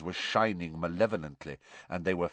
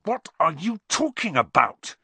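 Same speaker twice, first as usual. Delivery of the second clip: high, indignant demand; heated, exasperated question; angry; 9 semitones higher, 5 dB louder than usual. A narrator's reading. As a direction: snap angrily at a taunter. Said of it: "said Harry angrily".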